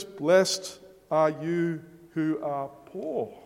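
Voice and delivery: slow, solemn Blessed are you who are poor; measured reading of scripture; slow quoted beatitude. Acted. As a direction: let each word of Jesus settle. Preacher voices Jesus slowly.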